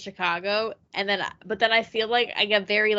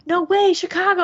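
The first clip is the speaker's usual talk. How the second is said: high, loud, thrilled disbelief; excited gasp; 10 semitones higher, 9 dB louder than usual. Retold or imitated. A coworker from Chicago, met at work, gets a high, loud, excited reply. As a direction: gush with mock delight at meeting a fellow Chicagoan.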